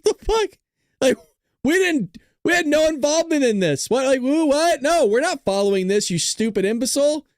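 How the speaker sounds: high-pitched, incredulous comic protest; mock outrage. Streamer voicing Israel's reaction to the announcement, mock outrage. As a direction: sputter in exaggerated disbelief, pitch way up. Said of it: "Israel comes out going".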